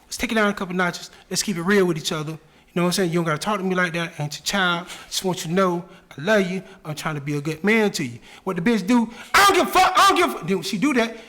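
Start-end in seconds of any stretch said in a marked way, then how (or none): 0.1-8.2 low, soft calming voice; calm soothing. Retold or imitated. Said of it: "That's how he talk to his bitch". 9.3-10.5 put-on high, sassy girlfriend snapping back; mocking woman voice. Woman voiced, raised pitch.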